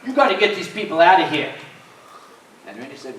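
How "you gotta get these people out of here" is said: louder, faster, higher order; bossy demand. Retold or imitated. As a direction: push it like a pushy cop. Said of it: "he said".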